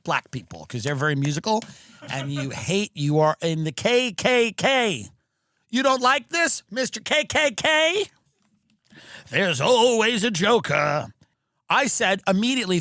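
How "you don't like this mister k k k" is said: mock taunt. Heckling an audience member mockingly.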